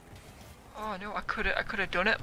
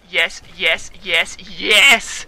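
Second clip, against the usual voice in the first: triumphant yeses; 9 dB louder, much slower than usual. Real, not acted. Celebrating a win.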